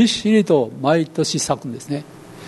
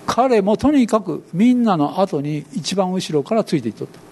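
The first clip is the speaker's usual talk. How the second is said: ordinary delivery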